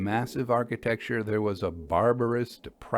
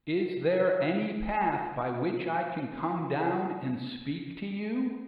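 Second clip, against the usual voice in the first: calling question; 8 semitones higher than usual. Acted. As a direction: ask across a long drop, lifting on you. Narrator asks man below.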